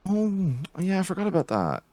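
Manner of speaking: high falsetto "oh yeah, I forgot"; airy high-pitched dismissive recollection; mock remembering. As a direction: play the shrugging forgetter up high. Mocking forgetful people.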